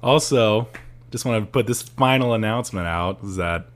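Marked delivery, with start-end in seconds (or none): none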